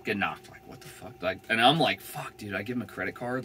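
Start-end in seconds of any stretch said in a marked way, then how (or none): 0.5-1.1 hushed, voiceless swear; whispered curse. Real, not acted. Muttering after knock. 2.0-3.4 soft, hushed self-scolding; muttering to self. Retold or imitated.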